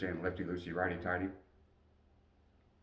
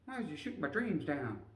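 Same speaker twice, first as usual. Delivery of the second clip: high whiny voice, much higher; mock hurt dad; 9 semitones higher than usual. Dad mocking his son's big plans, high and whiny. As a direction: whine it like a wounded dreamer.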